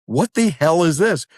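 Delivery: high-pitched incredulous demand; retelling it as an appalled reaction; retold disbelief. Retold startled reaction to cats.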